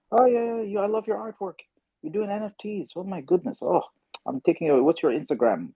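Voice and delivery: high, gushing fan voice imitated; excited fan. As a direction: play the thrilled stranger raving about the artwork. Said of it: "He was all excited".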